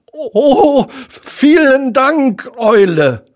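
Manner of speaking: high, loud, slow piglet thanks; drawn-out grateful relief; relieved. A narrator's reading. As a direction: let relief pour out in a high little voice. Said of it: "sagte Ferkel erleichtert".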